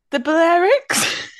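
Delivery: high, slow, unsure guess. Retold or imitated. Unsure high guess at the taxi driver's question. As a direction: pitch it up like a wild guess. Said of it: "I went".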